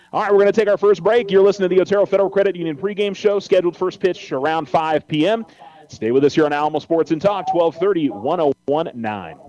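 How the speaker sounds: brisk upbeat announcer read; radio station ID read. Acted. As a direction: punch the break like a radio promo.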